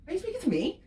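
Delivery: high-pitched mock disbelief; affronted retort. Dominatrix answering a client who demands money back, affronted. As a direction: challenge them as if insulted, pitched high.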